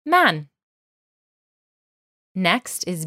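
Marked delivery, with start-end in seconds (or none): 0.1-0.5 Man repeated high and clear; Persian word. Shown as a demonstration. Teacher repeats the word for learners. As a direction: say Man once more as a model. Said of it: "Or I".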